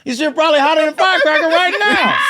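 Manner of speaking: high, loud comic outburst; shouted teasing. Real, not acted. Piling on a friend's car confession, high shouted teasing. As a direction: shout the joke over the laughter. Joking pile-on amid laughter.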